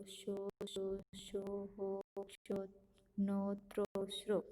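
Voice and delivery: slow, deliberate chant of each syllable; syllable drill recitation. Shown as a demonstration. Teacher reads the o-vowel chart aloud to pupils, measured and clear. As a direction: recite the chart one syllable at a time for children.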